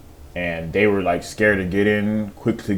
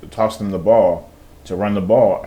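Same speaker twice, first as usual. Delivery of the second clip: ordinary delivery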